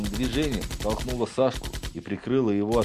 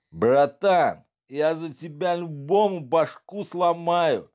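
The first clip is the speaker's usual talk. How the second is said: put-on drunk loyal thug; 8 semitones higher, 3 dB louder, slower than usual. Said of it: "посмотрел на друга пьяными глазами".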